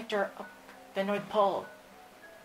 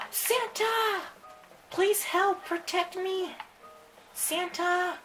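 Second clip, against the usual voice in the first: pleading call; 10 semitones higher, 4 dB louder, much slower than usual. Acted. Calling Santa for protection.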